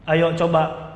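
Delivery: ordinary talk, nothing marked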